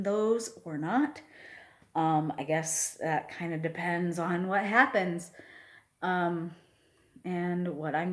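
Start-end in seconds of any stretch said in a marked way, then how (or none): none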